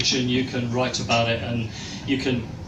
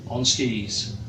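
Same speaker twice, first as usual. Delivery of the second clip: usual speaking voice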